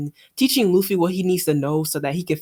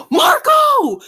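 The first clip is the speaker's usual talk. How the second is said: shouted name; 8 dB louder than usual. Acted. Reading a character shouting.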